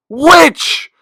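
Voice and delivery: piercing high shout at full volume; child shouting witch across the restaurant; toddler yelling. Retold or imitated. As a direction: yell it out as loud as a toddler can. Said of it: "yells as loud as she can".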